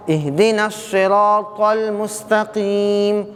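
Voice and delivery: slow melodic recitation; Quranic recitation. Shown as a demonstration. Teacher reciting the verse to the class, slow melodic chant.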